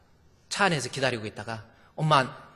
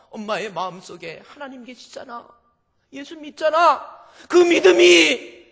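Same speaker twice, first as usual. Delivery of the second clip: fervent preaching; 11 semitones higher, 9 dB louder than usual. Real, not acted. Preacher proclaiming mother's faith, loud and high. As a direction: preach it at full force.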